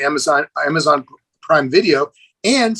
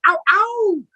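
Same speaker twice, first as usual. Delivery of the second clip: excited yelp. Retold or imitated. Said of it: "And I was like".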